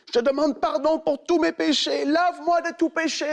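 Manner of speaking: fervent prayer. Retold or imitated. Boy prays for forgiveness.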